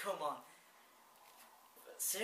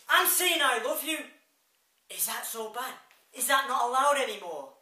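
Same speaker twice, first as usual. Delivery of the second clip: loud, raised-pitch outburst of hurt; indignant pleading; 3 semitones higher, 11 dB louder than usual. Acted. Rejected lover protests that he loves her, loud and indignant. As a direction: protest it at full voice.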